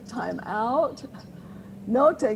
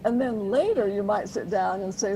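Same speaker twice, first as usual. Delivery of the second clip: normal talking voice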